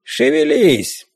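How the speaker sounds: slow, lightly creaking ghost order; creaky ghost. A narrator's reading. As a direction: drag it out like a stiff old ghost. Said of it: "проскрипел он".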